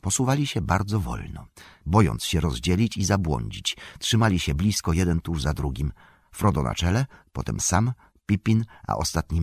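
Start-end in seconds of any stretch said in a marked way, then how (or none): none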